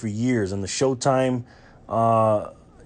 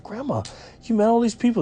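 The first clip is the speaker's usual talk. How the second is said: put-on surprised kid; 11 semitones higher than usual. Kid voiced in grandma story.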